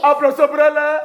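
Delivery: loud, chanted call for applause; rally the whole class, loudly. Real, not acted. Teacher to class after a pupil's answer, calling out.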